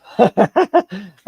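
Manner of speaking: big real laugh at his own joke; hearty laugh. Real, not acted. Laughing at the text he sent a friend. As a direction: burst out laughing.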